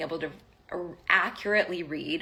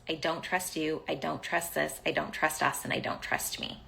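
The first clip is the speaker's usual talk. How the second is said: softer run-through without pauses; quick recital; 2 semitones lower, 4 dB quieter than usual. Retold or imitated. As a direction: rattle the list off quietly, tailing away. Recites same message in running list.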